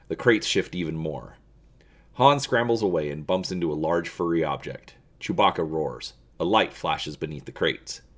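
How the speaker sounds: steady storyteller read; story narration. A narrator's reading. Reading a Star Wars gamebook to viewers, in a storyteller voice. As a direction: draw listeners into the dark scene.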